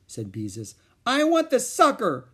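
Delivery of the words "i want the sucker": high, loud tantrum scream; a narrated tantrum; child's scream. A narrator's reading. Ramona screaming for the lollipop. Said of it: "screamed Ramona".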